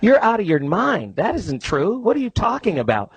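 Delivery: dad acting out his daughter's defiant challenge; mouthy daughter. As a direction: imitate a kid talking back, pitch jumping up. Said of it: "What she's saying is".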